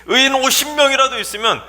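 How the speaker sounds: high, forceful demand; combative challenge. Acted. Abraham challenging God over fifty righteous, combative high-pitched protest. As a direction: come in spoiling for a fight. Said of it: "하나님께 막 싸울 기세로".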